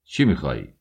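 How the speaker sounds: low, curt demand from an irritated officer; officer irritated by the young man's bold stare, curt demand; angry officer. Acted. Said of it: "او عصبانی شد".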